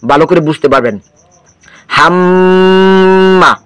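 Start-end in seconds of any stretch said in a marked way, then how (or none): 2.0-3.5 হাম্মা with a long, steady hum on ম; held ghunnah. Shown as a demonstration. Teacher showing students how long to hold, হাম্মা with prolonged ম. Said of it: "ধরে রাখতে হবে".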